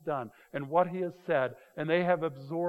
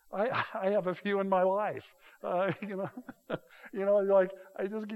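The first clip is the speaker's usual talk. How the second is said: chuckling through the words, higher; real laughing; 5 semitones higher than usual. Real, not acted. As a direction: laugh at your own confession. Laughs at himself.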